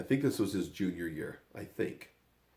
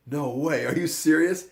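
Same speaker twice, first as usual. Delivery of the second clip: raised, louder astonished reaction; imitated disbelief; 7 semitones higher, 7 dB louder than usual. Dad hearing his son was cut, astonished disbelief. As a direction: react as if you can't believe it.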